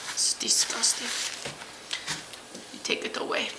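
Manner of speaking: unvoiced whisper of disgust; whispered grossed-out aside. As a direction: hiss it under your breath.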